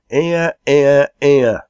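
loud, high "hear, hear"; rowdy pub approval; loud cheer. A narrator's reading. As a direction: shout approval across the room. Toasting the chairman's speech.

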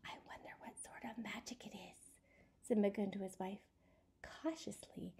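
soft hushed whisper all through; suspense whisper. A narrator's reading. Storyteller whispering to children at the scary part. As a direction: hush the listeners, build suspense.

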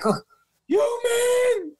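a high yelled "you, man?" of disbelief; incredulous challenge. Retold or imitated. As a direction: scoff at him as if he's nothing. [name] challenging him.

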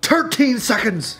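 slow, high Irish boast; Conor McGregor impression. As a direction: drawl it out like a cocky Irish fighter. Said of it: "what would he say".

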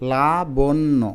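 লাবণ্য in slow parts, la-bon-no; syllable by syllable. Shown as a demonstration. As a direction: sound out লাবণ্য carefully. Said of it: "আলতো করে উচ্চারণ করো".

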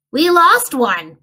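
LOST stretched, slow and loud; stress on lost. Acted. Sibling insisting to Bruce, "lost" hit slow and loud. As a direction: lean hard on "lost", slowly. Children confirm one is missing.

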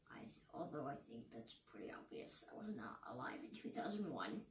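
very soft, low, near-whispered aside; hushed aside. Real, not acted. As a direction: drop to a whisper, as a side note. Aside about own age.

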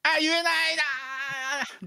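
long, high drawn-out whine; comic lament, pitched high and stretched; mock wail. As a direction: wail it out, dragging the last vowel. Wailing admission he can't say it.

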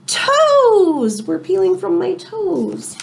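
story reading. A narrator's reading. Teacher reading a storybook to her class, slow and drawn out. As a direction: stretch the toes line out for the kids. Teacher reads picture book aloud.

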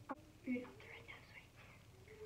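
secret whisper, voice gone. Real, not acted. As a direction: whisper the title so she can't hear. Secret song title for camera.

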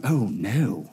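understated groan of worry; mock dread. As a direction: drop low and quiet in sudden dread. Religious leaders panic at crowds.

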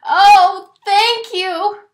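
high, loud relieved thanks; grateful scream. A narrator's reading. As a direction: shout thanks to the heavens. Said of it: "Lou screamed".

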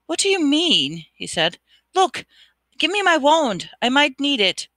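high, loud insistent demand; urgent demand. Acted. Harry demanding his wand back from Riddle, insistent.

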